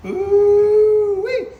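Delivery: long, high, loud ooh-wee; loud high whoop of approval; excited ooh-wee. Real, not acted. Showing off seasoning color.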